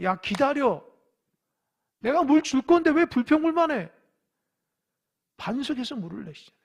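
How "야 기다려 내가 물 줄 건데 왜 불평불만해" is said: scolding God. Retold or imitated. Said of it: "하나님 왜 우리를 고생시킵니까".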